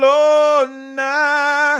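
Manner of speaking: long high stretched announcer cry of Barcelona; drawn-out mock call. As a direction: draw out Barcelona like a stadium announcer.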